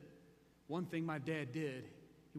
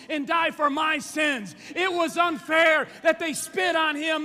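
high, very loud, drawn-out cries; impassioned preaching shout; 9 semitones higher, 16 dB louder, slower than usual. Real, not acted. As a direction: build to a full-force pulpit roar. Preacher's impassioned climax on unfairness.

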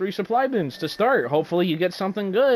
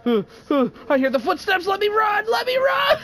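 high, frantic panic rising to a yelp; scared voice climbing into a shout; panicked; 14 semitones higher than usual. Real, not acted. Panicked fleeing in game.

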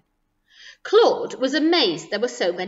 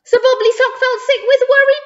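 loud, high, panicky narration; frantic worry; 13 semitones higher, 8 dB louder than usual. A narrator's reading. Narrator telling children of the friend's worry, frantically. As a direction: pitch it up in theatrical alarm.

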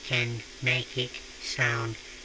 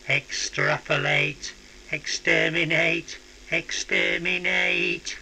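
robotic alien chant; Dalek; 4 semitones higher than usual. Shown as a demonstration. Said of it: "amount of Dalekness".

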